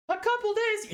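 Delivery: very high, disbelieving "A couple days?"; squeaky astonished question; incredulous squeak. Real, not acted. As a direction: shoot up in pitch at the absurdity. Speaker's own incredulous question.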